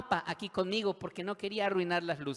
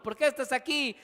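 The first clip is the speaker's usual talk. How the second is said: high-pitched, insistent questioner; prisoner interrogating; 10 semitones higher, 7 dB louder than usual. Acted. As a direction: quiz a fellow inmate in a raised voice. Inmates asking each other.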